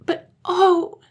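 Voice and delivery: put-on very high, loud little-girl voice; high childish dismay; child's lament. A narrator's reading. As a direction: wail it like a helpless child. Blue Eyes, a child, laments.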